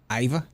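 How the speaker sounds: "Either" as "EYE-vuh", high and rising; dropped R. Shown as a demonstration. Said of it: "you Aussies don't pronounce your R's".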